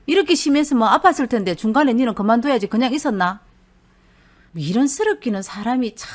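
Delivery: loud, fast exasperated scolding. Acted. Husband scolding his bruised wife, loud exasperated scolding. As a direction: tell her off for putting up with it.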